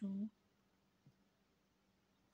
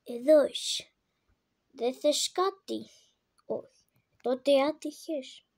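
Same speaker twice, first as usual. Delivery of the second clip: loud, emphatic address; exasperated scolding; 11 dB louder than usual. Real, not acted. Player gives up on baby, loud scolding.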